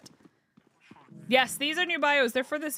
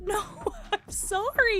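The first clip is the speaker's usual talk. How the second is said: high, slow, laughing apology; apologetic no to a child; 10 semitones higher, slower than usual. Retold or imitated. Narrator refusing the toddler, sorry. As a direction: let the toddler down gently. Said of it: "And I said".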